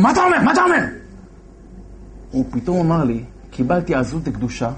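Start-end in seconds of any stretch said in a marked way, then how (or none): 0.0-0.8 high, sharp bark of disbelief; angry commander. Retold or imitated. Base commander demanding what the soldier said, high and sharp. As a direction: snap the question back high.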